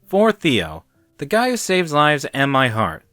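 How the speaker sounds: cocky guy reads the note; reading aloud smugly. A narrator's reading. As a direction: read it out like it's for you. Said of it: "reading it aloud".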